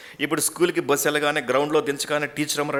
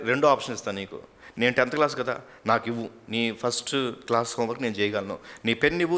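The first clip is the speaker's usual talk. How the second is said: ordinary delivery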